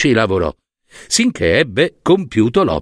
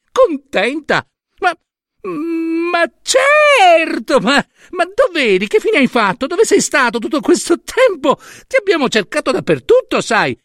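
Hera, falsely delighted. Acted. Hera greeting her returned son with much higher, falsely overjoyed voice. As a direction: gush over him with a big fake smile.

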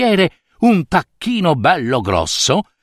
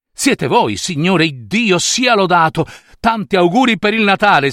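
higher, eager well-wishing; happy greeting; 5 semitones higher than usual. Acted. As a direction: greet him with delighted surprise. Scrooge saluta il benefattore. (saluto gioioso e caloroso)

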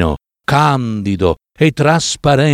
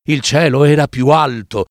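ordinary delivery

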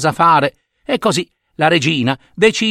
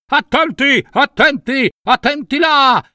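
high-pitched, repeated alarm call; storybook shout of danger; warning cry; 11 semitones higher than usual. Acted. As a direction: call out urgently to bystanders. Warning shouts behind the queen.

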